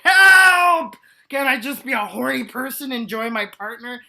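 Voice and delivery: high, loud cry for help; mock desperate plea. Butch in the nineties begging to just enjoy sex, loud mock plea. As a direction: plead like someone trapped. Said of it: "You're just like".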